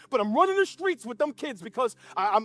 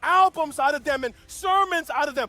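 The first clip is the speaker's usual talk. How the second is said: full-force preaching with raised pitch; impassioned preaching; 4 semitones higher, 5 dB louder than usual. Acted. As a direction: preach it in long, rising, emphatic phrases. Preaches at full force, voicing God.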